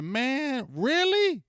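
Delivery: squeaky high disbelief, then a loud flat repeat; can't believe what he's hearing; sky-high really, then a loud flat one; incredulous. Real, not acted. Incredulous reply to angry fans.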